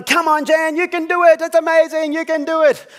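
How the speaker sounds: high, loud cheering encouragement; rally her like a crowd; cheering group. Retold or imitated. The group cheering Jan on, high and loud. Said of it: "everyone's going".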